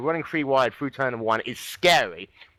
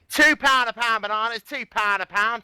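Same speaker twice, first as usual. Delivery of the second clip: high market-trader Cockney, louder; Cockney accent; 9 semitones higher, 3 dB louder than usual. Shown as a demonstration. Host demonstrating a Cockney accent to co-commentator, market-trader Cockney call. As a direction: bark it like a Cockney fruit seller. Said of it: "it's, it's easy".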